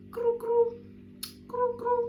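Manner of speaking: high chirpy ringing noise; phone ring imitation. Imitating the phone ringing to announce a call. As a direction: mimic the phone buzzing.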